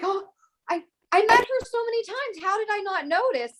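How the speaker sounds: high-pitched retold astonishment; appalled disbelief. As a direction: imitate a friend amazed at their own blindness. Imitates friend appalled she never noticed.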